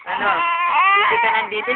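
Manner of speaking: lightly voiced high teasing; teasing quote. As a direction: tease the baby in a high playful voice.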